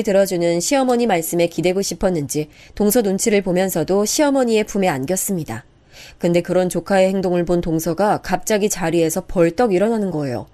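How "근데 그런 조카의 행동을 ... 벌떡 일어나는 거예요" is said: ordinary delivery, nothing marked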